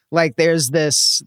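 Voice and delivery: usual speaking voice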